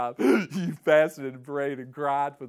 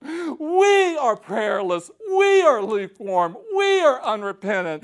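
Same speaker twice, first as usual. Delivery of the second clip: higher, louder, slow, tearful confession; crying prayer; 9 semitones higher, 12 dB louder, slower than usual. Acted. Preacher acting his own weeping prayer for the church. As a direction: plead like you're breaking down in prayer. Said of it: "I go".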